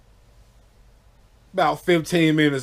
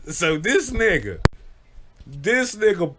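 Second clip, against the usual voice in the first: laughing; 6 semitones higher than usual. Real, not acted. Laughing telling the story.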